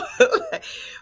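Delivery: a high, airy giggle; breathy laugh. Real, not acted. Mom delighted by her kids' praise, airy giggle.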